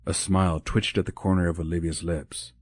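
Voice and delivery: plain reading voice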